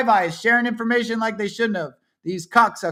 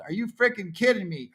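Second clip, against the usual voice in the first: ordinary talk, nothing marked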